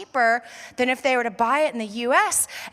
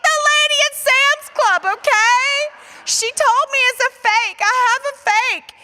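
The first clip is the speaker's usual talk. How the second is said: 1 shrieking defensively; 12 semitones higher, 7 dB louder than usual. Retold or imitated. Shouting defensively at cousin Patricia.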